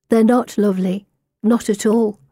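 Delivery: plain reading voice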